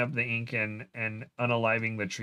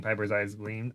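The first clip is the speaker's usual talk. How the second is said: normal talking voice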